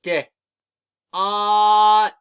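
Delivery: D then ot, each sound held long and loud; D, then ot, sounded out slowly and loudly; sounding out words. Shown as a demonstration. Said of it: "Repeat after me".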